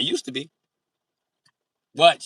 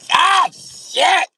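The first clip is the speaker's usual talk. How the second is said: high loud yelp of pain; scalded yelp; 11 dB louder than usual. Acted. Comic scalded by the shower, yelping out loud. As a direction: yelp like the water burned you.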